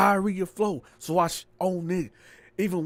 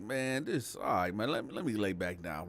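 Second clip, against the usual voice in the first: tired mumble; 7 semitones lower, 8 dB quieter than usual. Retold or imitated. Tired landlord gives up.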